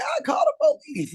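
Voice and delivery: high squealed yell; mock shout. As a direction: shriek it like a panicked caller.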